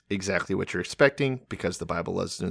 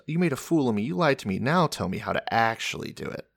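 ordinary delivery

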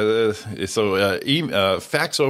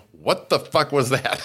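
dad's mock outrage, a little higher; retold shocked outburst; 5 semitones higher, slower than usual. Son imitating dad stopped dead on the dance floor, shocked. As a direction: stretch it out in disbelief, laughing.

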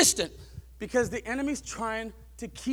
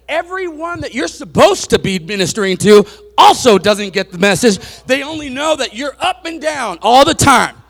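louder, driving the point home to the congregation; full-force preaching; 15 dB louder than usual. Acted. Preacher warning the congregation about unsteady leaders, loud preaching. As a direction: preach it hard from the pulpit.

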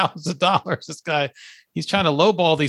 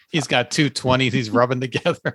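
normal talking voice

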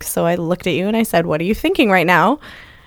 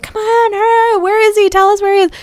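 urgent pleading; 13 semitones higher, 4 dB louder than usual. Retold or imitated. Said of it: "being like".

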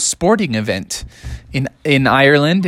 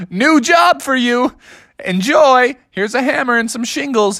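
cheeky parents; 11 semitones higher than usual. Acted. Parents give Bubby the roofing job, high and cheeky. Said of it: "And they were like".

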